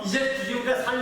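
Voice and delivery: ordinary talk, nothing marked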